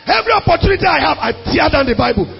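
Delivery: high, impassioned pulpit delivery; full-force preaching. Real, not acted. Pastor telling church why he preaches, full-force preaching. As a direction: preach it hard, pitch lifted.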